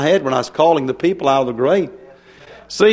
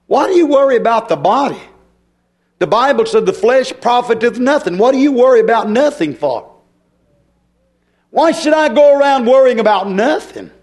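raised, insistent preacher's voice; full-force preaching; 5 semitones higher, 4 dB louder than usual. Real, not acted. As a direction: proclaim it with rising fervor.

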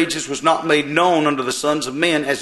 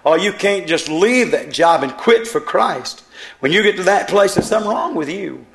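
fiery preaching; 5 semitones higher than usual. Acted. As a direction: build it up from the pulpit. Preacher at full force.

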